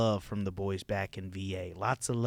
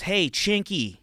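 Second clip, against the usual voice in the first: loud, higher-pitched mocking voice; raised, heckling voice of a talking watch; nagging Apple Watch; 6 semitones higher, 10 dB louder than usual. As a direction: needle him loudly like a pushy gadget. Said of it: "The Apple Watch goes".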